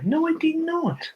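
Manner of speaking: put-on high squeaky childish protest; mock whiny denial.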